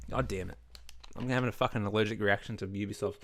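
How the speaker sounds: low, spent voice after a coughing fit. Real, not acted. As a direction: mutter it, throat still scratchy from coughing. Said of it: "oh, I'm gonna sneeze".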